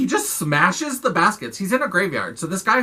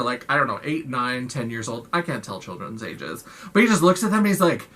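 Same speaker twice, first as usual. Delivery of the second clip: usual speaking voice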